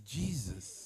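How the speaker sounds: low hushed Jesus; awed hush. Retold or imitated. A nonbeliever sees the shining face, hushed awe. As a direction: breathe Jesus softly in wonder. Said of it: "and then they say".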